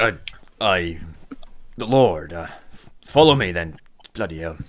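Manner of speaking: halting, flustered guard; taken aback. Acted. Guard unprepared for angry pirate captain, taken aback. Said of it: "He looks suddenly taken aback".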